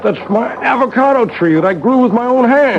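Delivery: high, indignant protest; indignant outburst. Acted. Clockmaker defending his tree to his sister-in-law, indignant. Quarrel over the window plant.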